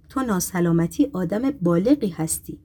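ordinary delivery, nothing marked

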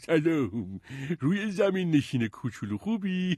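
chuckling official in a high put-on voice; high-pitched, jolly character voice; fat, grinning officer. A narrator's reading. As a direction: greet the little one with a broad grin and a laugh. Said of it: "مأمور چاقی که ... لبخند پت و پهنی داشت".

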